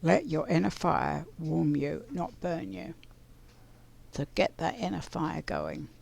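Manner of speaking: ordinary talk, nothing marked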